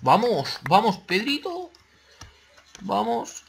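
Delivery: high, urgent "come on" cheer; rally yourself mid-fight; excited cheering. Real, not acted.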